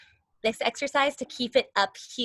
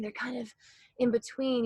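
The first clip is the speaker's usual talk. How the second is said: normal talking voice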